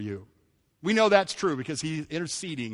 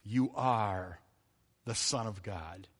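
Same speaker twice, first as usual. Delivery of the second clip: lower, softer awed confession; hushed worship; 8 semitones lower, 7 dB quieter, slower than usual. Retold or imitated. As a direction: breathe it out in awe. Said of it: "they said".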